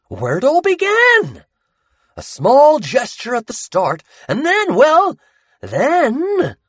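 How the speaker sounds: high, loud, slow ominous tease; teasing Sammy. Acted. Sammy teasing Buddy about where Jacob is. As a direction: dangle the secret and savour it.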